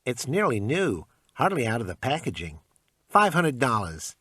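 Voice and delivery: pawn shop salesman. Acted. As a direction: sell it like a pushy clerk. Shopkeeper haggling.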